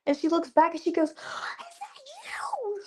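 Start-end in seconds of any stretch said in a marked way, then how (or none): 1.1-2.8 very high, drawn-out breathy mock scream; imitated screaming. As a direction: stretch out a high, hushed squeal of disbelief. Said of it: "She's screaming".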